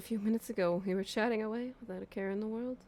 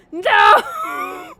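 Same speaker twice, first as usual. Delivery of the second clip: much higher, much louder mock crying shout; hammed-up wail; 16 dB louder than usual. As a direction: overact a grief-stricken no. Wailing over Yoko's death.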